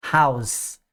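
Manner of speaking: House ending in a voiceless S; unvoiced final s. Shown as a demonstration. Teacher demonstrating singular house, voiceless S. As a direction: close House on a hissed S, no buzz. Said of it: "Som de S, não é vozeado".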